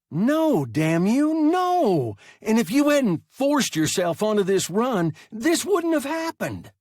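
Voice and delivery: high, loud furious outburst; furious shout. A narrator's reading. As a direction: scream it in a rage. Boy screaming at Hertz.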